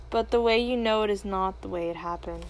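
usual speaking voice

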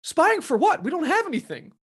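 high, pleading mock protest; mock nervous spy. Townsfolk protesting to Flagg's men, high and pleading. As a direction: whine the excuse up high.

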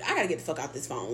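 low, soft and fast under the breath; muttered aside. Retold or imitated. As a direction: grumble it to yourself, quickly. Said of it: "And I'm just like".

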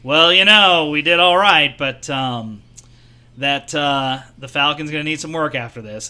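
lightly voiced Star Wars pilot; Han Solo pilot voice. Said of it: "And I was like".

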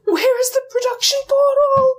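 very high mock-tearful wail; comic wail. Lost new starter hunting the production portal, comic wail. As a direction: squeal it in hammed-up despair. Lost newcomer wails in retold story.

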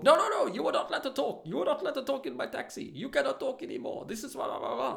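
high-pitched mock tirade, loud at the start; hammed-up high, ranting imitation; mimicked angry driver. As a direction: cut in as an outraged man forbidding it. Acts out the driver's furious ban.

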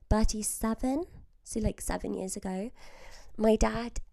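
ordinary talk, nothing marked

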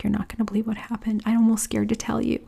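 whispered fast and high, almost afraid to say it; hushed whisper. Retold or imitated. Telling her husband what happened, in a fast, high whisper.

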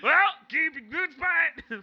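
high, loud, slow squeak with a laugh; future Andy voice. Acted. Future Andy signing off to Victor in a squeak. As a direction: squeak the sign-off. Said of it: "talk like this at all times".

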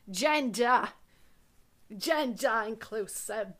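hammed-up New England accent, dragged out; mock New England drawl, dropping the R's. Showing co-host how New England says it, exaggerated New England accent. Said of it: "how we would say it here in New England".

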